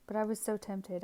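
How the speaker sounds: normal talking voice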